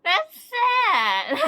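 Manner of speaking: loud, high fake-crying wail; mock wail. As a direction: ham up the heartbreak. Got an unwanted member again.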